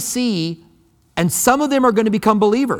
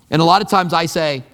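ordinary talk

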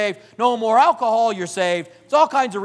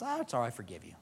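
gentle God; 10 semitones lower, 15 dB quieter, much faster than usual. Retold or imitated.

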